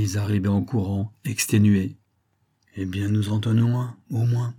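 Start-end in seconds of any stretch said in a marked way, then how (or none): none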